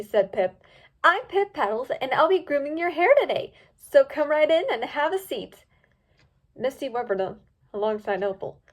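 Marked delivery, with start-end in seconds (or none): 1.0-5.6 bubbly cheerful stylist; bright welcoming pony; Pip voice. A narrator's reading.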